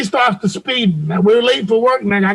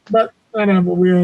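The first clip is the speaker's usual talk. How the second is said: ordinary delivery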